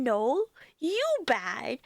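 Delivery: put-on slow, teasing baby talk thrown back at him; mocking baby talk. Aunt mocking her toddler nephew back, slow teasing baby talk. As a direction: mimic the toddler to tease him, slowly. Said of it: "I make fun of him and I point at him back and then I go".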